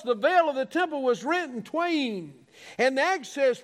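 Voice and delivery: high, ringing sermon cadence with falling phrase ends; fervent preaching. Real, not acted. Preaching with rising fervour.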